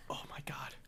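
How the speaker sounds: breathy embarrassed whisper to self. Real, not acted. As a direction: whisper it, half ashamed. Embarrassed aside to himself.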